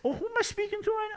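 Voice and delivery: high sweet fake-polite phone voice; mock polite, pitch way up. Phone call role.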